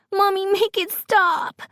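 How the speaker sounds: put-on very high insistent child's wail; commanding little Grace. A narrator's reading. Sick daughter begs mom to stop it, commanding child's voice. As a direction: demand it in a squeaky toddler voice. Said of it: "Grace commands".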